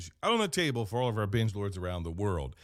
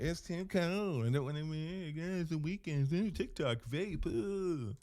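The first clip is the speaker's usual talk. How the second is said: high, soft whining mimic of bored kids; mock the kids' petulant whine; whiny kids; 4 semitones higher, 6 dB quieter than usual. Father mimicking his bored kids, lightly whiny.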